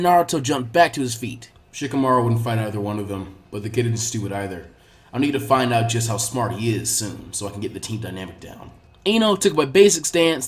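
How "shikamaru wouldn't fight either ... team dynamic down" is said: a hint of a lower, musing sensei; low, quiet, pondering inner voice; lightly voiced Asuma thinking. A narrator's reading. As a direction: think it through quietly to yourself.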